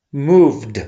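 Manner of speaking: "moved" with the ED said as D; final D sound. Shown as a demonstration. Teacher giving an example of ED after V, final D sound. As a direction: end "moved" on a voiced D, one syllable. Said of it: "we pronounce D".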